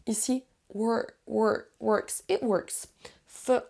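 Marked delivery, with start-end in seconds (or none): 1.3-3.6 slow syllable drill on WORKS, 'wur, wurks'; drawn-out 'wurks' for WORKS; O said as 'uh'. Shown as a demonstration. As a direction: build up WORKS slowly with an 'uh' vowel. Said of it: "le O et le O ici se prononcent quasiment comme un E".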